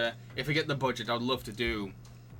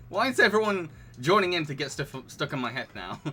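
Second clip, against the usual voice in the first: ordinary talk, nothing marked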